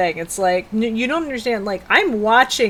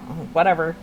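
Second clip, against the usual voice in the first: dismissive shrug; 5 semitones lower, 5 dB quieter, much faster than usual. Retold or imitated. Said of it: "I go from zero to bitch".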